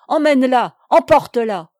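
loud, urgent command; desperate call. A narrator's reading. Jeanne calling her father to remove the maid, loudly pleading. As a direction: call out for help to be rid of her.

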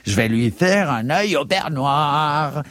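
high, slow, menacing clerk voice; slow, high-pitched threat; threatening Dindonneau. Acted. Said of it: "s'écria Dindonneau".

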